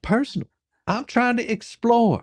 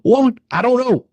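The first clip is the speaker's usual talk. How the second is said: louder, higher exasperated snap at the witness; exasperated retort; 5 semitones higher, 6 dB louder than usual. Real, not acted. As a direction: throw it back at her, fed up.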